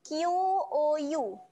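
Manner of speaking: "Q O U" sounded slowly letter by letter; slow sound-by-sound spelling; spelled-out sounds. Shown as a demonstration. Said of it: "kita akan bunyikan dengan".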